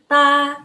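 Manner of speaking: "Ta" high, level and long; loud sustained "Ta" on one high pitch; first tone drill. Shown as a demonstration. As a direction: hold "Ta" high and even. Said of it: "Sekali lagi".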